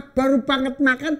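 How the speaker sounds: ordinary talk, nothing marked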